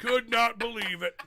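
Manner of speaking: high, slow mock shout; hammed-up amazed yell; loud imitated exclamation. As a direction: belt it out in a drawn-out comic impression. Voicing a character during a sex scene.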